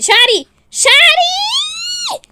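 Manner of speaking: very high, long wail; pained shriek. Acted. Woman's hair is pulled in fight, shrieking. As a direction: scream it out, stretching the end.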